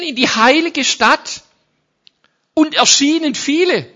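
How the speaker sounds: loud, high, excited proclamation; full-force preaching. Acted. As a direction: shout out the wonder of it. Dramatic preaching of resurrection. (mit voller Kraft gepredigt)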